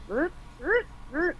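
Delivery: high little mechanical chirps; imitated droid head-turn. Fan repeating the funny head-turn sound, imitated high whirs. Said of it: "when he's like".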